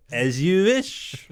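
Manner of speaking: slow, drawn-out movie line; slow dramatic quote. Pretend. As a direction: deliver it like a grand film line.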